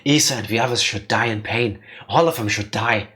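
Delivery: low, bitter ghost voice; vengeful shadow. Acted. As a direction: intone a grudge from the grave, low.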